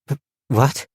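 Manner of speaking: mild stammering confusion; stammered. A narrator's reading. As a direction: caught off guard, fumbling. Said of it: "stammered Fern".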